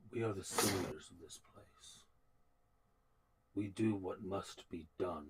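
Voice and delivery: low, soft, measured oath-like speech; solemn soldier. Acted. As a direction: speak low and quietly, with grave restraint.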